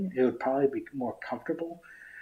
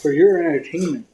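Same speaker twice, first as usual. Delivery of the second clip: showman announcement; 3 semitones higher, 10 dB louder than usual. Pretend. Showman flourish during magic stream.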